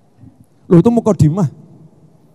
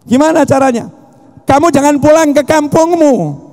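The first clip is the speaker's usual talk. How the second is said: preaching shout; 8 semitones higher than usual. Real, not acted. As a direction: preach it hard, climbing to a shout. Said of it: "saya ngomongnya lemah lembut atau teriak-teriak".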